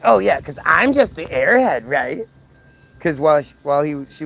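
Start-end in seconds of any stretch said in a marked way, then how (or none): 0.0-2.3 sarcastic mimicry. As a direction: imitate her snide, wounded sarcasm. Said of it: "popping in and saying shit like".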